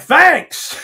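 loud, high, hammed-up thanks; hearty shouted thanks. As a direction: call back a big thank-you, retelling it. Retold hearty reply to yelled insult.

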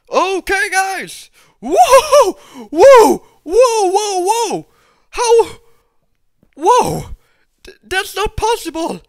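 very high, loud whoas; astonished shouting. Real, not acted. Gamer startled in the cave, loud high astonishment. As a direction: burst out in shocked disbelief.

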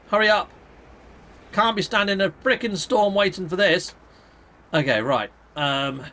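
usual speaking voice

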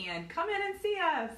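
normal talking voice

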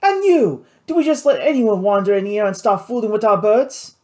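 high, loud scolding; angry pirate boss. Acted. Louis reeling around to face Marcel, angry. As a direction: turn on him with a high, indignant outburst.

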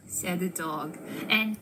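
usual speaking voice

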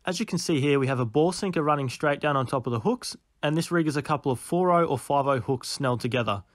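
quiet, steady instructional voiceover; voiceover narration. A narrator's reading. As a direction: explain the rig calmly, close to mic. Voiceover explaining the bait rig.

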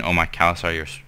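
ordinary talk, nothing marked

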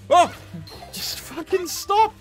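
high, slow drawn-out cry; exasperated yell. Real, not acted. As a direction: plead in loud frustration. Frustrated at game.

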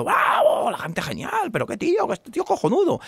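high, breathy cheers of bravo; cheering crowd. Acted. Onlookers cheering the Basque man's catch, high and breathless.

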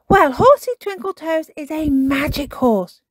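put-on high, loud child character; Peppa, persuasive. A narrator's reading. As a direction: talk it up eagerly to a friend. Peppa persuades Susie with magic.

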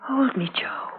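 breathless whispered plea. Acted. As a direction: whisper it close, urgently. Wife asks husband to hold her.

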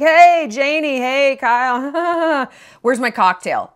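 licht gespeelde jaren-vijftig vader; lightly voiced old-school dad. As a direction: speel nonchalant een ouderwetse vader. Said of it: "They should come home like".

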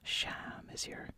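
ordinary delivery

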